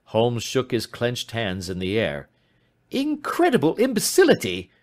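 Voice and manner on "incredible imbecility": high, loud outburst of indignation; exasperated cry. Acted. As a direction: cry out at such stupidity. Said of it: "he cried".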